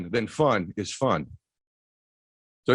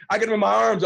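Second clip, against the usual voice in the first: ordinary talk, nothing marked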